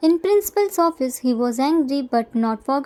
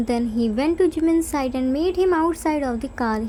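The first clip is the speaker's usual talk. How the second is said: ordinary delivery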